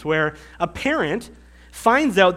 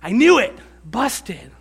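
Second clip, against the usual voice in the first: louder, smug gotcha; triumphant gotcha; 4 semitones higher, 6 dB louder, slower than usual. Retold or imitated. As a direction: catch them out and gloat. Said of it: "the parent turns back".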